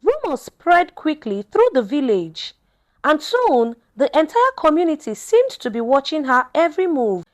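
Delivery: plain reading voice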